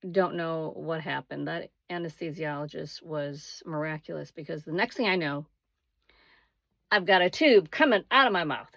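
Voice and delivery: ordinary talk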